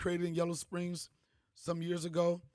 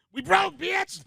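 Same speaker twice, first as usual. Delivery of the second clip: high, loud shriek of "We broke, bitch!"; shouted punchline; 12 semitones higher, 11 dB louder, much slower than usual. Retold or imitated. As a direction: yell it up high in comic panic. Punchline told to Austin's mom.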